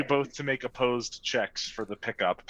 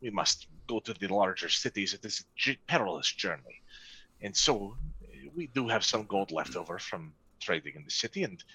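low, soft-spoken merchant; 8 semitones lower, 9 dB quieter than usual. Acted. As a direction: speak low and humbly as a poor merchant. GM merchant NPC pleading.

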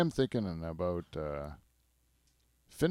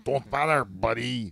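put-on dismissive Québécois accent, a bit louder; mock Quebec accent; 2 semitones higher, 4 dB louder, slower than usual. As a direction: brush him off like a gruff Quebecer. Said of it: "They always say it like this".

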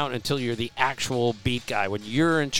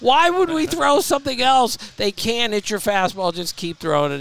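a raised, higher mock scold at a pitcher; Dutch scolding; 8 semitones higher, 4 dB louder than usual. Pitching coach retold scolding his starter, mock exasperated. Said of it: "Dutch looks at him and goes".

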